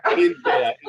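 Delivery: high, crying shout, voice breaking. Real, not acted. Host overcome while friend cheers, crying out. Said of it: "I'm getting emotional".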